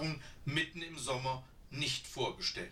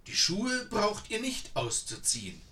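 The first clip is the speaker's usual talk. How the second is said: put-on high, louder, insistent old woman; eager old woman; 8 semitones higher, 5 dB louder than usual. A narrator's reading. Old woman offers tea to the tired sisters, high and eager. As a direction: coax them in like a keen old granny.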